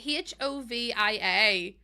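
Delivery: letters spelled out slowly. Shown as a demonstration. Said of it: "spelling the name".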